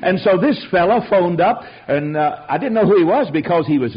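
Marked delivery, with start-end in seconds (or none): none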